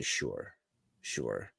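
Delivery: low, soft whispered sure; quiet grudging agreement; muttered sure. Real, not acted. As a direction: concede under breath.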